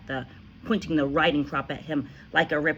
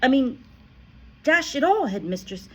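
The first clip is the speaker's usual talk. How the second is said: higher schoolboy protest; boy protests to headmistress; a hint of a puzzled boy; 9 semitones higher, 7 dB louder than usual. A narrator's reading. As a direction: object with mild surprise.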